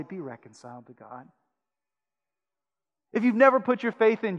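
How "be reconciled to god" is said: low, hushed plea; soft plea. Acted. Pastor inviting the wandering back, soft plea. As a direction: drop to an intimate invitation.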